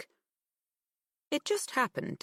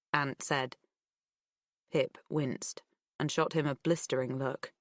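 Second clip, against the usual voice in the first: ordinary delivery, nothing marked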